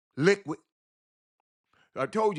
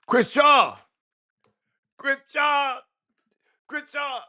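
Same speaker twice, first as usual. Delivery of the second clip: high, excited name call; 12 semitones higher, 6 dB louder, slower than usual. Real, not acted. Calling out a caller's name.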